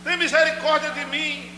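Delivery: high pleading call; Bartimeu crying out. Acted. Blind man begging Jesus for mercy, high cry. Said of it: "imagine Bartimeu aí gritando".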